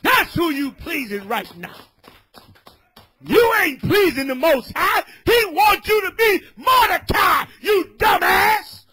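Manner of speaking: shouted, high street sermon; fiery preaching. Acted. Street preacher scolding a brother, shouted preaching. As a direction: preach it full force at the crowd.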